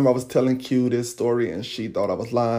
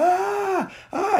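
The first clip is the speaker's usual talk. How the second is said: high-pitched drawn-out wail; imitated howl of pain, not at full volume; retold pained scream. Bitten attacker crying out, imitated pained scream. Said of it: "yelling and screaming".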